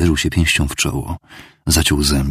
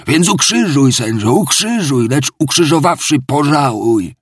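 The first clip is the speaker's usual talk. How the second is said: higher, louder fervent plea; inspired drunken preaching; 8 semitones higher, 4 dB louder than usual. Acted. Drunk clerk preaches to the tavern crowd, fervent. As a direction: preach your own crucifixion.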